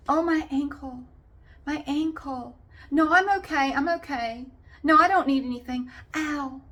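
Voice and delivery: whiny attention seeker. Retold or imitated. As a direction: ham up the injured drama queen, fishing for sympathy. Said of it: "they just kept going".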